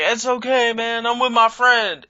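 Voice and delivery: put-on high, loose drunk buddy; drunk friend voice. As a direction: play the happy drunk friend. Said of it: "He was like".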